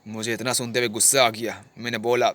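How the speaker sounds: ordinary talk, nothing marked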